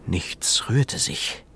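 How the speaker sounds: slow, hushed whisper. A narrator's reading. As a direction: breathe it out in suspense. Hushed narration.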